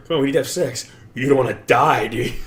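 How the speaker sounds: loud, high, pushy come-on; hammed-up seduction pitch; mock pushy pitch.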